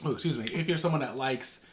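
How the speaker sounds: normal talking voice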